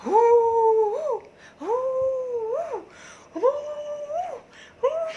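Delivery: put-on long, piercingly high toddler howls; imitated tantrum wail. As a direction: mimic a three-year-old screaming in waves. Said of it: "just saying like this".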